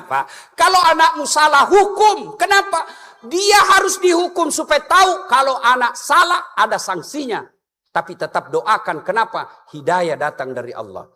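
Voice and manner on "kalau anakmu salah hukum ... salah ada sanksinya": emphatic preaching. Real, not acted.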